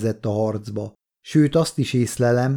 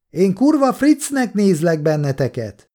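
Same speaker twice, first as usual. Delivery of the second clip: raised-pitch answer from afar; distant voice calling; 6 semitones higher than usual. A narrator's reading. Distant soldier answers the men in the house, calling back. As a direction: call back roughly across a distance. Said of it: "egy távoli hang válaszolt".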